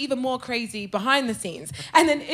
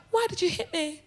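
small, high, wounded question; soft, restrained protest, staying in character; holding it down; 5 semitones higher, 7 dB quieter than usual. Retold or imitated. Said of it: "I just held it down".

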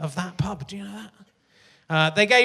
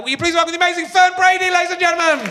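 much higher and louder, big announcer welcome; showman's welcome; 10 semitones higher, 4 dB louder, faster than usual. Real, not acted. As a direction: sell the guest to the whole room. Host introduces guest to audience.

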